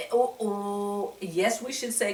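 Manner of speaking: ordinary delivery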